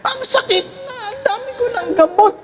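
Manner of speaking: put-on high, fast whiny old-lady voice; complaining old woman. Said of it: "Sabi nga ng isang matanda".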